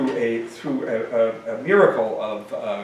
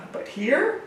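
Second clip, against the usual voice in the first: much higher, small helpless voice. Acted. Jamie protests weakly to Jake, high helpless squeak. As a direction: squeak it out, cornered. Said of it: "I asked helplessly".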